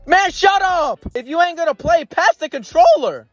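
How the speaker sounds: high, loud frustrated shout. Real, not acted. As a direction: yell it at him. Yelling at the hesitant character.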